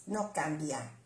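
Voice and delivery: usual speaking voice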